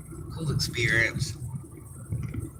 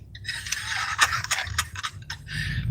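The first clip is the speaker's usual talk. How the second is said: soft laugh; 7 dB quieter than usual. Real, not acted. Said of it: "I'm still laughing".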